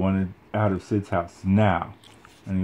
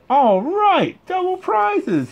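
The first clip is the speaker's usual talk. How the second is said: put-on very high, louder greedy kid whoop; gleeful yell; 4 dB louder, much slower than usual. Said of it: "he yelled".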